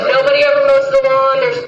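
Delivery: high, loud mock disgust at the messy house; mocking disdain. Speaker mocking the neighbors' messy yard, high disdain.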